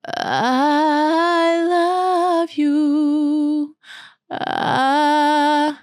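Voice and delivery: slow sung phrase starting in fry; vocal fry onset. Shown as a demonstration. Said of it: "Можно начать ... со штрабаса".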